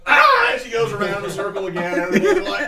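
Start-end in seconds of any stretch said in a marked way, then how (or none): 0.1-0.5 very high, loud shriek; spinning gnome shrieking at the player; high startled cry. Acted. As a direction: shriek as she spins past. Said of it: "she's looking at you like".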